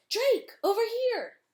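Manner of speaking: high, loud call for help. Acted. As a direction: shout across the cave to be found. Trapped friend calling for help.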